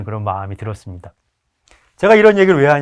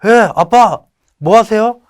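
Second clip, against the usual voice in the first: son's cheerful call; 8 semitones higher, 8 dB louder, slower than usual. Retold or imitated. Son calling his dad.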